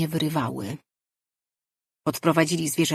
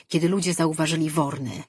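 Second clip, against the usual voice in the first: ordinary delivery, nothing marked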